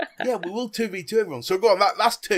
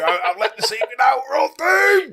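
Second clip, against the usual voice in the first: higher, louder hammed-up doom cry; mock panic; 8 semitones higher, 5 dB louder than usual. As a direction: wail that all is lost in jest. Mock panic over spy secret.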